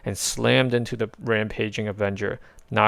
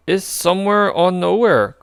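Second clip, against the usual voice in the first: higher, louder impatient woman; 9 semitones higher, 7 dB louder than usual. A narrator's reading. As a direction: snap in to clarify. Gamora voice.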